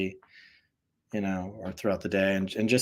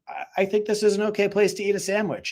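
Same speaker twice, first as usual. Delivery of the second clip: high, deadpan innocent excuse; mock-innocent, much higher voice; innocent reply; 11 semitones higher, faster than usual. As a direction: act totally innocent about the sandwich. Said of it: "And I was like".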